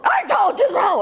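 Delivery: high, loud shouted retort; triumphant shout. Retold or imitated. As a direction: yell it down the phone. Daughter grabs phone, yells, hangs up.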